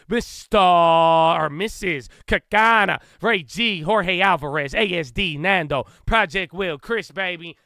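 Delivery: loud, punchy announcer greeting each name; hype shout-out roll call. Real, not acted. Hyped livestream roll call of viewers.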